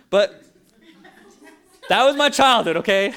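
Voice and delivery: loud, high, laughing punchline; laughing exclamation. Real, not acted. Preacher owning up to his childhood, loud and laughing. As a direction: blurt it out through laughter.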